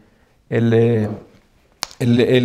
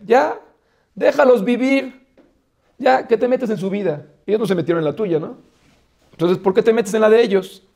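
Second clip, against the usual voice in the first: higher, scoffing voice of a doubter; mock skeptic; 6 semitones higher than usual. Teacher voicing a skeptic who scolds Moshe, higher and scoffing. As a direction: challenge him like a nosy critic.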